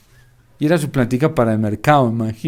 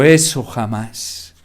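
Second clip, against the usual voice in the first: stern refusal; 4 semitones lower, much slower than usual. Acted. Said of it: "el Señor se le puso serio".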